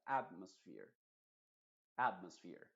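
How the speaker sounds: atmosphere, AT loud and sphere dropping low; stress on AT. Shown as a demonstration. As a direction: punch the first syllable of atmosphere. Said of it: "el énfasis principal debería estar en la primera". (atmosphere con énfasis principal en la primera sílaba AT)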